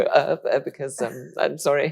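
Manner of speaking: halting, mock-timid stammer; flustered apology. Eleven-year-old answering an older pupil, flustered. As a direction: fumble for an answer, slightly high. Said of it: "when you're eleven years old".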